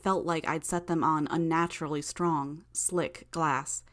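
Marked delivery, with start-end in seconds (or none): none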